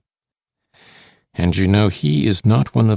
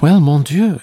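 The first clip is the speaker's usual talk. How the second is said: high, louder exclamation; exclaimed; 7 semitones higher, 5 dB louder than usual. A narrator's reading. Solange answering his doubt about the street, exclaimed. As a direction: burst out with it. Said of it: "she exclaimed".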